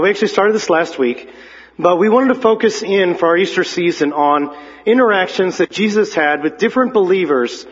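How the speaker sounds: normal talking voice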